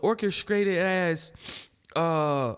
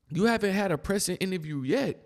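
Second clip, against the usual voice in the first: ordinary delivery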